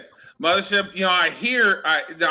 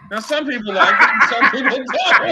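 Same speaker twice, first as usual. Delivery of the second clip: laughing, pitch climbing higher; cracking up at his own joke; giggling punchline, higher; 7 semitones higher, 5 dB louder than usual. Real, not acted. Laughing punchline about gross drink.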